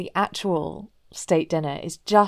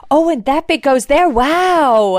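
loud, raised mock amazement; hammed-up wonder; endlessly surprised; 6 semitones higher, 6 dB louder than usual. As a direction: imitate childlike fascination at every little thing. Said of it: "endlessly surprised by how this sari works".

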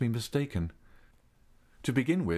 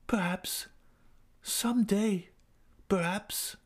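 wistful Christine; 11 semitones higher, slower than usual. A narrator's reading. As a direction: leave him a faint hope.

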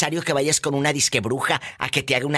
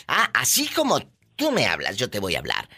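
ordinary delivery

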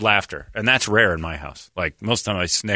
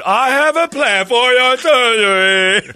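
mock doctor; 12 semitones higher, 7 dB louder, slower than usual. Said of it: "If you roll a doctor in".

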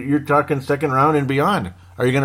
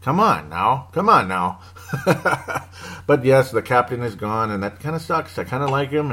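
ordinary talk

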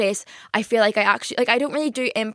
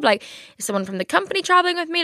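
high confused wondering; 9 semitones higher, faster than usual. Retold or imitated. Confused airport story.

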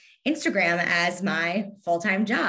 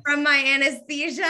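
laughing it off, pitched up; 7 semitones higher, 5 dB louder than usual. Real, not acted. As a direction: giggle through the words. Laughing about being high.

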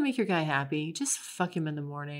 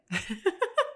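laugh; 12 semitones higher than usual. Real, not acted. Laughing at her own joke.

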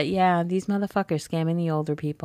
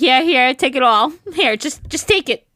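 frantic grandma; 8 semitones higher, 7 dB louder than usual. Pretend. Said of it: "I'd be like".

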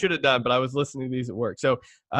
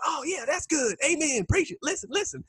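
high, soft mock congregant voice; imitating gushing congregation; 11 semitones higher, 4 dB quieter than usual. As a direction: do churchgoers egging on the preacher, pitched way up. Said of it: "And that accent, too. That's exactly how they say it".

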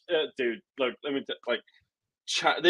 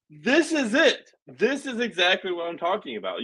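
vindicated exclamation; 6 semitones higher than usual. Retold or imitated. Podcaster retelling his own reaction, high and triumphant. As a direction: savor being proven right. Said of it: "And I'm like".

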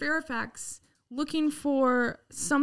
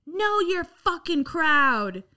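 slow, higher, louder mock sneer; mocking a young protester; 6 semitones higher, 5 dB louder, much slower than usual. Imitating a protester sneering at the cops, slow and loud. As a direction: imitate the girl jeering at cops. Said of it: "this one little young little girl goes".

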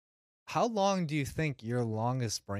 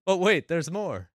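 louder hyped-up pitch, higher; home shopping host; 5 semitones higher, 7 dB louder than usual. Pretend. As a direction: sell it like a late-night TV ad. Classic infomercial line.

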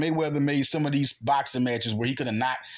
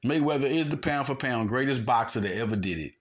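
ordinary talk, nothing marked

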